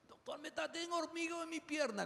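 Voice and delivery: high, soft patient voice lifted well above his own; timid high patient describing a tingling leg; complaining patient. Retold or imitated. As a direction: play a diabetic telling the doctor about tingling. Lecturer voices diabetic patient.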